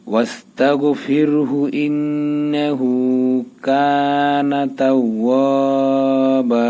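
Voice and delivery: very slow melodic chant, vowels long held; Quran recitation. Shown as a demonstration. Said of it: "আমরা মিলাই পড়ি".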